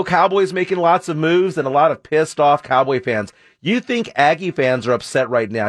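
ordinary talk

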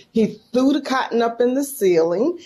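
ordinary talk, nothing marked

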